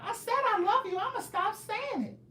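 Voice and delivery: mock a commenter in a squeaky put-on voice; high-pitched teasing impression; imitating a viewer. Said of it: "That's how y'all talk".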